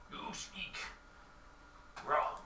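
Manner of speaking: very soft, broken delivery; creature's halting speech. Acted. Bugbear correcting the stranger, hushed halting creature voice. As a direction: leave a long gap before the last word. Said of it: "it's like".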